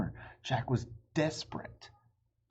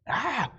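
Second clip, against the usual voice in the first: sharp frightened yelp; startled cry; 7 dB louder than usual. Acted. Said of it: "he cried".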